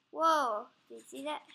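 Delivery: ordinary talk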